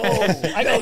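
ordinary talk, nothing marked

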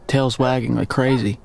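usual speaking voice

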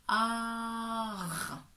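"ar" held long, A sliding into R; syllable demo. Shown as a demonstration. Teacher gives the answer for A then R, drawn out. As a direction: draw out "ar" slowly. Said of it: "ça fait donc".

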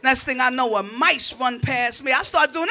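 usual speaking voice

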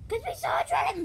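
very high, excited blurt; excited squeal. Pretend. Bon Bon claiming they saw a dragon, high excited squeal.

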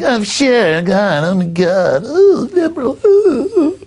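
very high, swooning parody voice; hammed-up squealing moans of delight; mock gushing trendies. Host mocking trendies thrilled by the scheme, high gushing voice. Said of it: "The trendies will be like".

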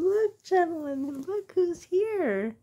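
high, sing-song greeting to the pets; cooing greeting. Real, not acted. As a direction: coo at them like babies.

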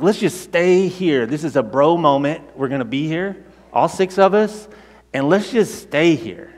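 a buddy begging to make the moment last; excited disciples. Retold or imitated. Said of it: "they get so excited about this that they're like".

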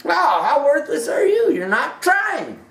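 high, scornful jeer that breaks into a shout; mock scorn rising to a shout; mocking contempt. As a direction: sneer it like the voice that kicks you when you fail. Voicing the world's contempt.

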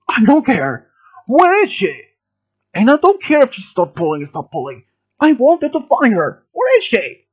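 high, loud acted yelling; screamed demand. A narrator's reading. Gunman rejects Milo's pleas, screamed demand. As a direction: yell it high and insistently. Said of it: "he screamed and demanded".

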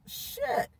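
normal talking voice

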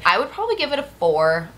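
ordinary talk

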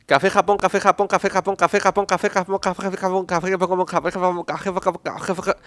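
"café Japón" repeated fast until it slurs; tongue twister falling apart into mush. Shown as a demonstration. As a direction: rattle "café Japón" nonstop until the words blur. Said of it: "lo voy a intentar una vez".

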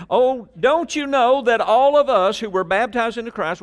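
high, loud scripture reading; forceful preaching. Real, not acted. Preacher reading the verse to the congregation, full force. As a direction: belt out the verse to the room.

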